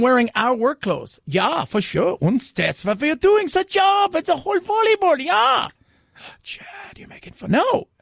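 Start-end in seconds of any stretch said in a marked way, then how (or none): 2.2-5.7 high, mock German accent. Host voicing German volleyball players in a German accent. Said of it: "you spoke with a German accent". 6.4-7.5 whispered teasing rebuke; whispered scold. Acted. Cohost scolding host's fake accent, in a whisper.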